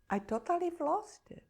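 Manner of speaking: high, quick half-laughing admission; rueful high exclaim. Real, not acted. Admitting breakdown after long pause.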